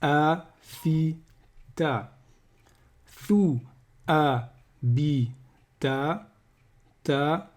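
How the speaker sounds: slow Arabic syllables read one by one, each cut off short; teacher reading out Arabic letters for repeating; syllable drill. Shown as a demonstration. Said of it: "vous répétez".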